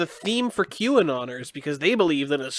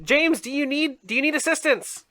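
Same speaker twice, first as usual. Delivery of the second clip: mock worried falsetto; 9 semitones higher than usual.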